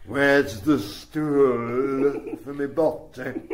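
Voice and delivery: slow, drawn-out comic character voice; Max Wall. Retold or imitated. Recalling his childhood impression of the comic, slow put-on voice. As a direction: stretch it out like the old music-hall clown. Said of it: "early impressions".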